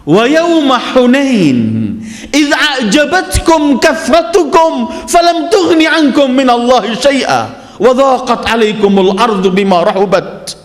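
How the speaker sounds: slow, high-pitched verse recitation; Quran recitation. Acted.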